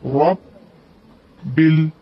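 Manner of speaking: ordinary talk, nothing marked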